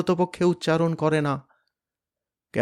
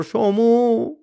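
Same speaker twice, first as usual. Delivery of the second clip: high, far-off strangled call; desperate father's cry; anguished cry; 10 semitones higher, 4 dB louder, much slower than usual. Acted. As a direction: cry out as you fall. Father crying out, collapsing.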